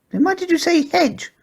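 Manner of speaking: very high questioning pug voice; a pug hearing a strange name; high pug voice, puzzled; puzzled squeaky pug. A narrator's reading. Porky's voice.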